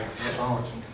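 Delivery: muttered aside, low and very soft; mock disbelief. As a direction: play the baffled onlooker under your breath. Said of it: "people look at you crazy".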